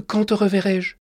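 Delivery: worried. A narrator's reading. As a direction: ask it fearing you'll never meet again. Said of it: "demandai-je inquiète".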